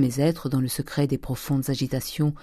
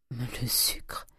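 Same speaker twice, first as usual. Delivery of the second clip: very soft, voiceless reply; hushed, barely audible whisper; whispered answer; 2 semitones lower, 9 dB quieter than usual. Acted. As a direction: answer timidly under your breath. Timid nephew answers stern uncle.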